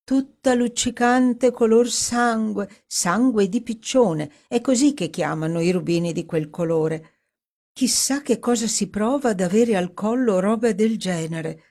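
wistful and enchanted, slowing on the first words; Dorothy daydreaming. Acted. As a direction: linger on the colour with longing.

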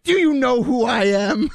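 high-pitched mock drunken outburst; mock shouting. Retelling a drunken correspondent shouting at security, high mock shout. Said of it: "she just started shouting at them".

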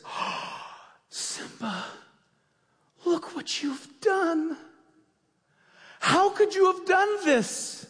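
from a hushed breath up to a high, loud, slow accusation; mock-shocked Scar. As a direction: play the villain feigning horror, building to an outcry. Scar's fake grief after king's death.

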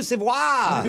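put-on hammy French accent; cartoonish French accent. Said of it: "on a French accent".

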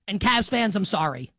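normal talking voice

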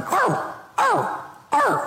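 high yappy mock bark; imitated dog bark. Imitating a phone ringtone that barks, high yappy arr. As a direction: yap like a small dog ringtone. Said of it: "I hear this barking".

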